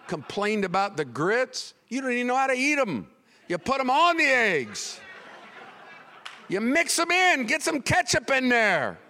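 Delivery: high, loud mock rant; snapping. Preacher scolding a complainer about the grits, hammed-up snapping. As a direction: snap indignantly, building to a comic yell. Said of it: "and I'll snap on 'em".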